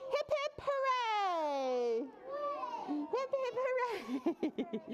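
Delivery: put-on high cheer for children to copy; playful cheer. Shown as a demonstration. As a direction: lead a squeaky hip-hip-hooray. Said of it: "Can y'all say".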